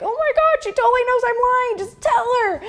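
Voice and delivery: very high, squealed panic; hammed-up frightened squeak; afraid. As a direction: squeal a panicked inner thought. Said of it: "I was so afraid".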